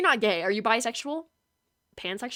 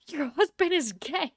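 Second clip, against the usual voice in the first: ordinary talk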